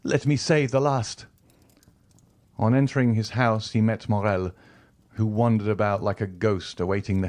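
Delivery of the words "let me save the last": desperate plea. A narrator's reading. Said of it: "he cried".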